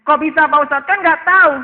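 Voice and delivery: pura-pura protes dengan nada melengking naik; protes tak terima. Acted. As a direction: bantah ustadz, tinggi dan lantang. Jemaah memprotes ustadz. (memerankan jemaah yang membantah keras)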